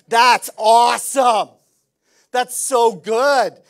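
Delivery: loud, slow, high joyful shout; real delight. Real, not acted. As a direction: let your joy burst out. Pastor thrilled at eager congregants.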